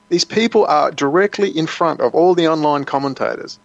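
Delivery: usual speaking voice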